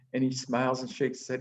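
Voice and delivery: normal talking voice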